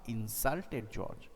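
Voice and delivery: usual speaking voice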